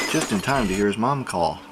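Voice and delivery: plain reading voice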